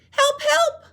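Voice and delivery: very high, loud cries for help; Little Penguin calling out. Shown as a demonstration. Teacher voicing Little Penguin calling for help. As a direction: yell through the snowstorm. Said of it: "show you how to use voices".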